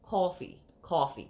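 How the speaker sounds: low, put-on New Jersey vowel; deadpan mock New Jersey accent. Shown as a demonstration. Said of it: "Isn't that, like, New Jersey?".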